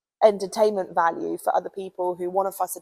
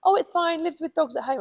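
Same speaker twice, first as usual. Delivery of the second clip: breezy parent; 8 semitones higher than usual. Retold or imitated. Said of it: "And the parent said".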